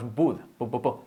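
would mispronounced "bud", lips closed on b; closed-lips error. Shown as a demonstration. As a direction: repeat "bu, bu, bu" with a popped b. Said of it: "cerramos los labios y decimos".

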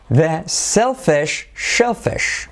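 "selfish" with s, "shellfish" with sh, drawn out; slow tongue twister. Shown as a demonstration. Teacher introducing a tongue twister, slow careful model. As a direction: separate s from sh very slowly. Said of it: "Esto sería desglosado".

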